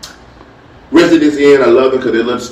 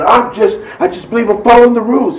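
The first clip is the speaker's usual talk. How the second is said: higher, defensive plea from an upset mother; a flustered mother voiced higher; 10 semitones higher than usual. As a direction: protest that you're only following rules.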